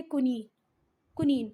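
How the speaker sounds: "कुनीन" said as koo-NEEN, long ee, not "कोनाइन"; correct pronunciation. Shown as a demonstration. Teacher showing students the right way to say "कुनीन", koo-neen. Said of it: "पर आपका इसका सही pronunciation क्या है?".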